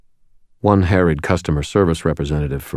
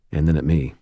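plain reading voice

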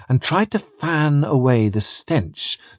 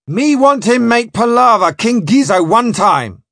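high, forceful captain in coast pidgin; loud demand; 10 semitones higher, 6 dB louder than usual. Acted. Spring demanding a palaver with the king, loud demand. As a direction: bellow the order in pidgin.

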